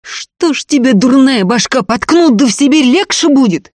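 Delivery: high, loud enraged questioning; furious shout. A narrator's reading. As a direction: yell at a stubborn fool. Ефим в бешенстве спорит с братом.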